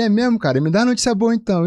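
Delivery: eager uncle. Retold or imitated.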